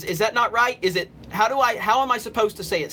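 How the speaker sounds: ordinary delivery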